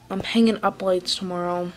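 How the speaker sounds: usual speaking voice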